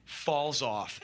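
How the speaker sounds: ordinary talk, nothing marked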